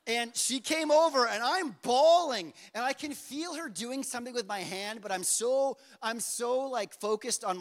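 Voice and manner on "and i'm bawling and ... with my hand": ordinary talk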